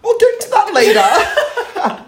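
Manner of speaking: high laughing aside; high-pitched laughing deflection. Real, not acted. Laughing reaction to king hit.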